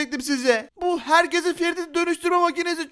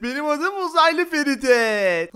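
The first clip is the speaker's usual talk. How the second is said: usual speaking voice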